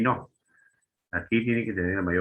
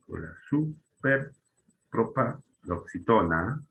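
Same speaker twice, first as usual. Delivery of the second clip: superpropaloxitona broken slowly into chunks with pauses; slow sounding-out; 4 semitones higher, much slower than usual. Shown as a demonstration. Teacher giving the other name for sobredrújulas, slowly sounded out.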